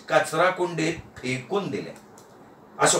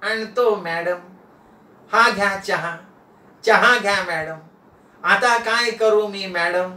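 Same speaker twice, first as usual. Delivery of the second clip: obedient, spellbound houseboy; entranced servant. Acted. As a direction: report dutifully, as if hypnotised. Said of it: "चॉकलेटच्या प्रभावानं नाना बोलायला लागले".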